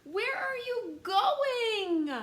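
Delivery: put-on high, slow little kid's voice; little brother. Storyteller voicing George's little brother asking where he goes. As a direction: ask it like a tiny curious brother. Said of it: "asked George's little brother".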